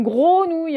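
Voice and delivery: "gros nouille" with a long o in place of "gre"; common mispronunciation. Retold or imitated. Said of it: "vous dites ça".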